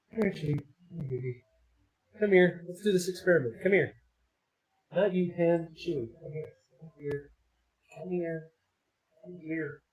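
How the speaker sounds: soft coaxing call; softly coaxing the cat closer. Real, not acted. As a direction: gently coax the pet over.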